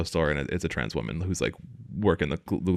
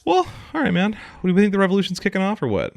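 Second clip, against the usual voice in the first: Louisiana accent, much higher and quicker; 12 semitones higher than usual. Shown as a demonstration. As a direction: put on a thick Louisiana accent on request. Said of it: "hear me talk Louisiana?".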